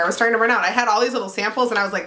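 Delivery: ordinary delivery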